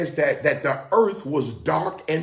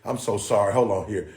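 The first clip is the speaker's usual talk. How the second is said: ordinary talk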